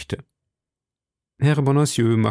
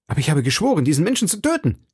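rising to a high, fast outburst; a young man swearing revenge, raised and rushed; cried out; 6 semitones higher, much faster than usual. Acted. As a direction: vow it in rising fury. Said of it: "rief D'Artagnan".

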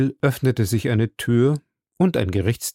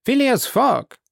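much higher, calling the name out; clerk calling out; 13 semitones higher, slower than usual. A narrator's reading. Clerk calling Phileas Fogg to the bench, high call-out. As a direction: announce the name up high across the court.

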